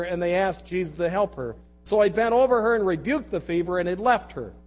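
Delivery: projected, declamatory reading from the pulpit; forceful scripture reading. Real, not acted. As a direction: read the passage out to a whole congregation. Resumes reading the passage aloud.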